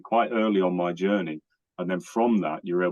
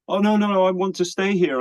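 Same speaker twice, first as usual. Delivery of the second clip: mock sweet protest, much higher and louder; passive aggressive; 11 semitones higher, 4 dB louder than usual. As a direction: play the ex's fake-sorry pushback, high and pointed. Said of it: "in a kind of passive aggressive way to say".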